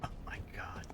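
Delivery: breathy whispered aside. Real, not acted. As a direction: whisper it under your breath. Mock exasperation at pirate pun.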